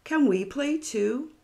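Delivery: put-on hopeful little boy. A narrator's reading. Stephen asking Sophie to join hopscotch, high hopeful voice. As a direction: ask sweetly like a small boy. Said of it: "asked Stephen Green".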